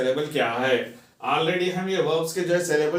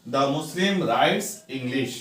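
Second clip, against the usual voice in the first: slow model sentence; much slower than usual. Shown as a demonstration. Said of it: "teacher models English sentence".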